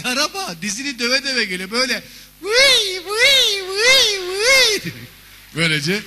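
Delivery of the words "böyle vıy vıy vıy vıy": much higher, louder crying wail; weepy siren. Pretend. Emotional ambulance arriving, sobbing siren wail. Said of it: "o da bir içli araba, bir hisli araba".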